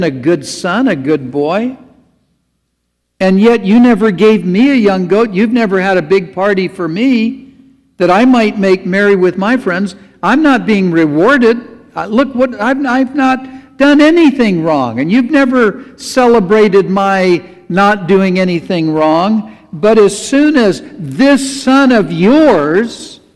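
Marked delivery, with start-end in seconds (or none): 3.2-19.6 loud, high, aggrieved complaint to his father; angry older son. Retold or imitated. Older son protesting to his father, angry and aggrieved. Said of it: "He answered and said to his father". 19.7-23.2 slowed, pointed "this son of yours"; slow angry complaint. Retold or imitated. Older son complaining to his father, slow and resentful. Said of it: "and I'm sure that's how he said it".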